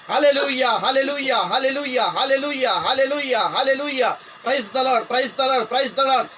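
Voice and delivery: loud, high, repeated praise chant; full-force revival praise; fervent praise shouting. Real, not acted. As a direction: pound out each word like a battle cry.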